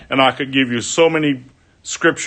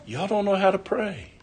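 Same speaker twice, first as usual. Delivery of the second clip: friend's chiding voice; 7 semitones higher, 6 dB quieter than usual. Retold or imitated.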